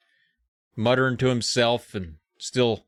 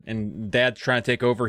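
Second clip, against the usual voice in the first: ordinary talk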